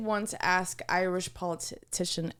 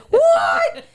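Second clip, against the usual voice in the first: 놀라서 소리치는 높은 what; shocked what; 13 dB louder than usual. Retold or imitated. Shocked at the price.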